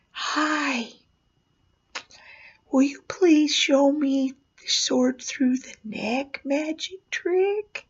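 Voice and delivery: put-on small, high, timid whisper of a little boy; a shy little kid whispering a request. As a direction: breathe it out shyly, high and slow. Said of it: "a timid little knock on the door".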